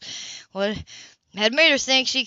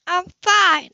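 higher, louder bitten-off retort; clipped anger; 8 semitones higher, 5 dB louder than usual. A narrator's reading. Anna brushes off Cam, angry. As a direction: snap it back.

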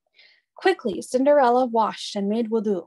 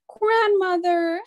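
high, slow tearful cry of recognition; sobbing; 9 semitones higher, 3 dB louder, slower than usual. A narrator's reading. Cinderella recognising her grandmother, crying out high and tearful. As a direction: cry out the word through tears, up high. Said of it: "she sobbed".

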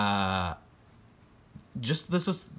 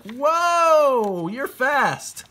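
much higher, loud, amazed whoop; amazed cowboys; 8 dB louder, much slower than usual. Retold or imitated. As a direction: holler like awestruck cowboys. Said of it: "and the cowboys are like".

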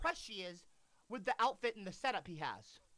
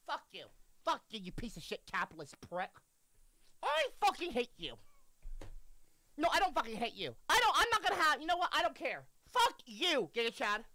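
agitated rant; 7 semitones higher, faster than usual. Real, not acted. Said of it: "I am not pissed off".

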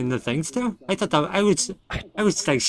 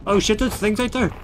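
high, urgent cry for help; panicked call; 10 semitones higher than usual. Real, not acted. Player sees monsters and calls teammate, panicked. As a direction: yell the name in panic.

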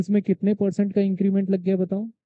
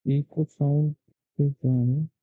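cave echo; 6 semitones lower than usual. Real, not acted. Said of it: "इको आ रही है।".

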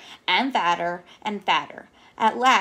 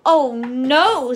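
loud storybook dismay; mock-sad exclamation; sad bee; 3 semitones higher, 8 dB louder than usual. A narrator's reading. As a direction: lament it out, acted. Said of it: "sadly flopping down on the grass".

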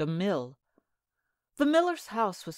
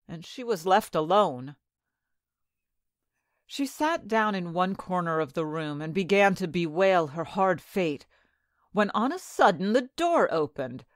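plain reading voice

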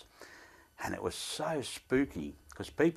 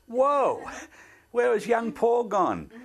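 surprised whoa; 6 dB louder, slower than usual. Retold or imitated. Seeing his aged face in mirror.

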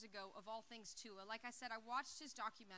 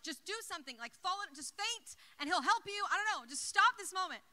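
higher and much louder, pleading; frantic loud urging; 5 semitones higher, 11 dB louder than usual. Retold or imitated. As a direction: push him to act, voice climbing and loud. Frantic urging of frozen brother.

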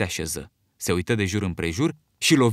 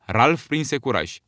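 ordinary delivery

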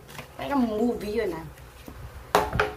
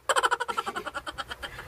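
giggle; 8 semitones higher, 6 dB quieter than usual. Real, not acted. Laughs after her own answer.

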